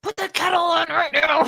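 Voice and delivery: high-pitched aggressive mock shout; mock mad. Told they sound aggressive, plays mad Brit shouting. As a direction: demand it like you've snapped, hammed up. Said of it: "'Cause I get mad".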